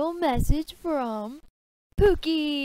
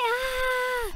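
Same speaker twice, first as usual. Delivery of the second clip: high whisper-yelled yelp; a high hushed shriek; whisper yelled cry; 8 semitones higher than usual. Acted. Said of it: "fake whisper yell I do for Hamtaro".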